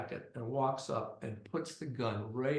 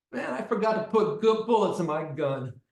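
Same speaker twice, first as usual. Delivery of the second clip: louder, higher acted gripe; mock complaint; 5 semitones higher, 6 dB louder than usual. Gunman grumbling to Fish about his gun, mock complaint. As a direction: complain loudly to your buddy.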